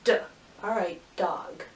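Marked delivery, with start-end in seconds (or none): 0.0-0.2 "D" as the short sound duh; first sound alone. Shown as a demonstration. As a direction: say "D" as duh, not the letter name. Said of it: "But that first sound".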